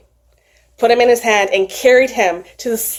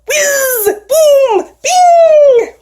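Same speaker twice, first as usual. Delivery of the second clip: magic whoosh sound; 6 dB louder, much slower than usual. A narrator's reading. Magic flight sound effect.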